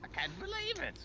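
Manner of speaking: shrill high hammy panic; panicked villain. Pretend. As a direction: squeal in cartoon villain panic. Villain panics, voiced dramatically.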